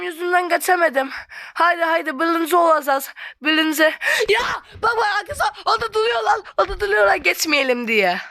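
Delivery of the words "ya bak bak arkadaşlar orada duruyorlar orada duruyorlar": alarmed shout. Real, not acted.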